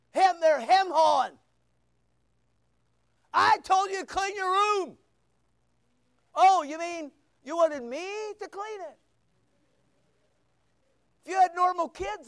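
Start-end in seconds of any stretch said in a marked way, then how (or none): 3.3-5.0 parent blowing a gasket. Retold or imitated. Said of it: "you never blew a gasket". 6.3-9.0 kid playing dumb.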